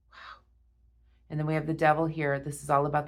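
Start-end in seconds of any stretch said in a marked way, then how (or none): 0.1-0.4 barely voiced, softer wow; hushed wow. Real, not acted. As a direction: let it slip out in a hush. Awed reaction to tarot spread.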